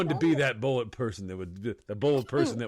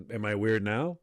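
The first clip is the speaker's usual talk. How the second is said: normal talking voice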